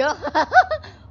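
a high sudden laugh bursting out; loud burst of laughter. Real, not acted. Laughing out loud when the game lags. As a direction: crack up at the lag.

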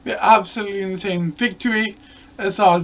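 ordinary talk, nothing marked

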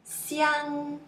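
Xiang held high and level; first tone. Shown as a demonstration. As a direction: sustain Xiang on one high note. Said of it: "Let's see more example".

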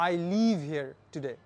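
\"live\" stretched with a long ee, slow; slow model reading. Shown as a demonstration. Teacher reads the example slowly for students. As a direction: draw out \"live\" so it sounds like leave. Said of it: "লম্বাভাবে বলছি নাকি ছোটভাবে".

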